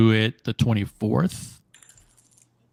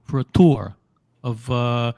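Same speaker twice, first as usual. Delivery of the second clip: like a Jersey gangster making a threat; New Jersey mobster accent. Retold or imitated. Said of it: "take on that accent".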